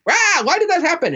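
high-pitched mock yell; imitated yelling. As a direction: imitate a wife suddenly blowing up. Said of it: "she's yelling".

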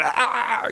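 high strained "ah" of a failing singing voice; failed singing croak. Retold or imitated. Guest showing the host his failed car singing, high strained croak. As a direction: imitate your voice giving out on high notes. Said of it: "and my voice is going".

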